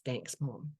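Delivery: very soft, retold thanks; quiet, tender imitation of a son; softly touched son. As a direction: murmur it like a grateful kid. Son hugs mother after her amends.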